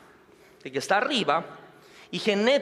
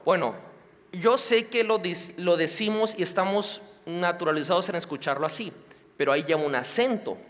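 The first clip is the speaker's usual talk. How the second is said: ordinary talk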